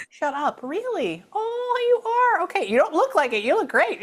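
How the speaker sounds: high-pitched, gushing disbelief; amazed delight. Real, not acted. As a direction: react with loud, high surprise and flattery. Amazed reaction to learning she's a mom.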